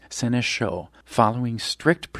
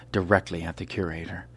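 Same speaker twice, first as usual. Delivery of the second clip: usual speaking voice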